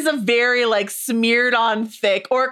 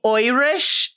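a quick jab of fake Irish; mock Irish accent; 3 semitones higher than usual. Host naming the Harfoot accent, mock Irish accent. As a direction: say Oirish with a thick stage-Irish vowel. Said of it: "accents of the Harfoots".

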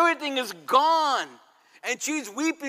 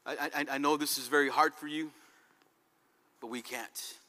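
tender consoling husband; 8 semitones lower, 7 dB quieter than usual. Retold or imitated. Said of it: "Job says".

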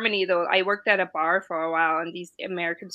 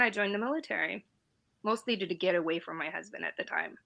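ordinary talk, nothing marked

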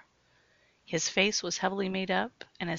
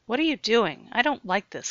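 complaining; 5 semitones higher, 6 dB louder than usual. Acted. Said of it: "complained Jared".

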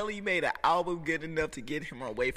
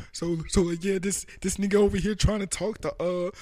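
mock guy on the phone; 5 semitones higher, 6 dB louder than usual. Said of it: "He was like".

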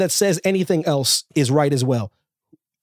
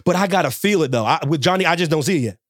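ordinary delivery

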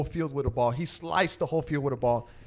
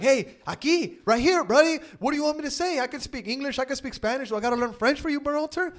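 teammate calling for ball; 9 semitones higher than usual. Retold or imitated. Host voicing teammate calling for the ball, mock shout. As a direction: play the teammate yelling to get the pass, pitched up and pushy. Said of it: "He was like".

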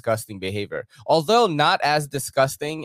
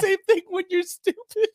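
much higher-pitched mimicry; mock echo. Co-host repeats the clip's punchline, high mock echo. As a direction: parrot it back up high.

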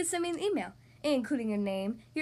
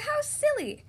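very high, mild shout; crowd's shout; 13 semitones higher, 4 dB louder, slower than usual. A narrator's reading. Crowd laughs at the silly idea, high shout. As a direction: call it out in a high voice. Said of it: "shouted the crowd".

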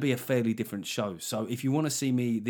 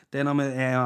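ordinary talk

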